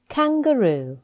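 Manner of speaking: normal talking voice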